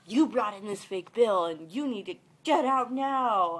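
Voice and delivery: loud, hammed-up order to leave; forceful pretend telling-off, building in volume; mock accusation. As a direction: play the over-the-top confrontation. Mock accusation she says she never made.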